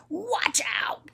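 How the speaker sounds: cross growl, drawn out; grouchy old man. Acted. Old man bumping into the boy, cross. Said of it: "the old man says".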